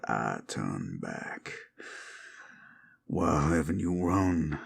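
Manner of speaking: southern werewolf warning the listener away. Acted. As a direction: menace someone who stayed too long.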